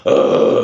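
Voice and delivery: loud, dull raspy "uh"; unprojected growl. Shown as a demonstration. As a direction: growl without throwing it out. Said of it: "if you just do it".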